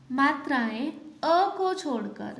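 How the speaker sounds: normal talking voice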